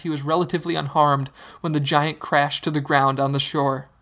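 ordinary delivery

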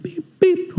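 high-pitched electronic beeps; imitated scanner beep. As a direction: mimic a card reader beeping.

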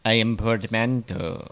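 low, hammed-up evil wizard. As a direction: proclaim it like a villainous sorcerer. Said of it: "sounds like an evil wizard".

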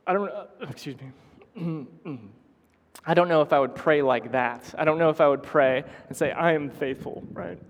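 choked up, halting and clearing the throat; real emotion catching mid-sentence; emotional. Real, not acted. Preacher doubting he could pray like that, emotional. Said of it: "I'm getting a little emotional".

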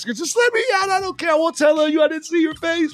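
very high, pleading panic near tears; crying in panic. Retold or imitated. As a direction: beg to be let out, voice cracking high. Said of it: "like, crying".

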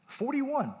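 high-pitched retold disbelief; incredulous. Preacher recalling shock at his neighbor's age, incredulous.

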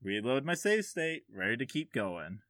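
ordinary talk, nothing marked

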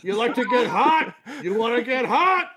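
light high-pitched imitation of a movie villain; a hint of Kurt's manic taunt; mock screaming villain. Quoting the Death Proof villain, lightly hammed-up high voice.

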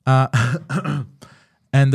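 ordinary talk

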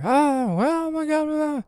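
high, alarmed cry of a camper; retold panicked yell. Vlogger imitating a startled camper, high alarmed cry. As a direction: squeal it out like someone spotting a bear.

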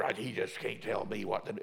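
low, soft dismissive grumble; lightly voiced sulky churchgoer; mock grumbling. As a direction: mutter it like a sulking member. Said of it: "Some people are".

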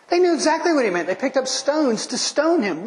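high, fast rising urgency; excited. Real, not acted. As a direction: let it burst out high and quick. Climax of argument.

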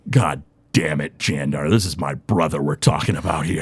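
low, soft, torn warrior pleading; a fighter defending his brother, muted and low; pained warrior. Acted. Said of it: "And he goes".

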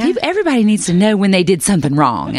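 high-pitched, animated mock mother objecting; imitating protesting mother. Mother objecting to niece's homework, in a high mock protest. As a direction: play an insistent mom, voice lifted high. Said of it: "and then my mother, who's a seven".

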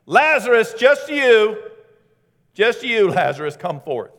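high, loud call singling one out; loud summons to one person; pointed call. Acted. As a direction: call one man by name, loud and firm. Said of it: "God had to specifically say".

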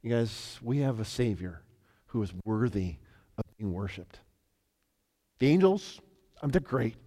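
quiet, earnest closing to the congregation; soft sincere appeal. Acted. Pastor to the church, a soft, sincere appeal.

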